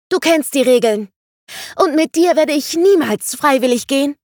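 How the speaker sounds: high, loud defiant snap; a small girl spitting defiance at her captor; hissing girl. A narrator's reading. The girl defying Vangelis who grabs her: high loud angry snap. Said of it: "fauchte das Mädchen".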